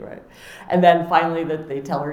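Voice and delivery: usual speaking voice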